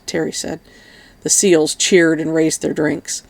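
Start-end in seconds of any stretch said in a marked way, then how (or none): none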